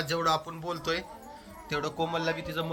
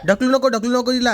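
calling out; 8 semitones higher, 14 dB louder than usual. Real, not acted. Father stopping his toddler from pushing her sister, loud call. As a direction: call out sharply to stop the pushing.

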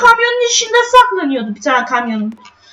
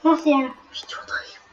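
soft secret whisper; whispered aside; 7 semitones lower, 11 dB quieter than usual. Pretend. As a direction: hush it to the mic.

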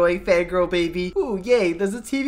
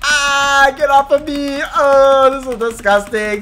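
loud, raised-pitch protest; hammed-up cries of disgust and pain; panicked yelling; 3 semitones higher, 6 dB louder, slower than usual. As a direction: yelp and fight off an attacker. Being grabbed by the fangirl.

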